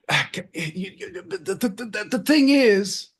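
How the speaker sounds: high, stumbling restart on the; acting out his own flubbed line, stammering and rising; stammering demo. Shown as a demonstration. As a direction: repeat the the the then punch thing. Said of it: "and I'll do that".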